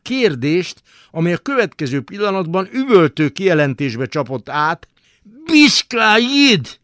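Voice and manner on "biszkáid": very high, loud, slow bellow; shouted. Acted. Attendants bellowing at the naked boy, loud yell. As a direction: roar it out of the steam. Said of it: "üvöltötte a gőzből".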